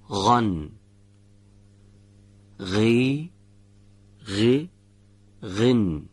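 غا, غی and غو each with a voiced throat fricative before the vowel; ghayn syllable drill. Shown as a demonstration. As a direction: sound out each syllable crisply, the voiced gargle first.

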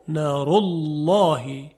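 نار الله slow, with the lam of الله thick after the u; heavy lam. Shown as a demonstration. Teacher models the heavy lam after a damma.

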